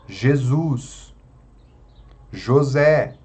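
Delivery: Jesus and José stressed on their final syllables; stress on last syllable. Shown as a demonstration. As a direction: separate examples, Jesus, José, each ending strong. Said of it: "sílaba forte é a última". (Jesus, José com a última sílaba forte)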